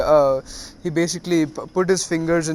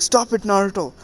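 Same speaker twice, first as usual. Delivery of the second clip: alarmed plea; 5 semitones higher than usual. Retold or imitated. Jiraiya tells a teasing Naruto to stop, mildly panicked. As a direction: plead with a mild note of panic.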